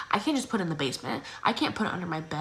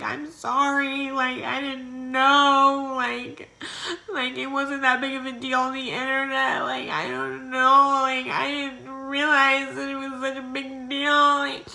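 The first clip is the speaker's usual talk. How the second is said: high, slow, whiny fake sob apology; fake crying; 6 semitones higher, 3 dB louder, slower than usual. Girl to the cop, slow high fake-crying apology. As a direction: put on a teary fake cry. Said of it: "just faking it".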